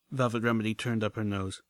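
plain reading voice

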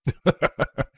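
short character chuckle; acted laugh. A narrator's reading. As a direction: give a brief acted laugh. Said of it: "Broadsword laughed".

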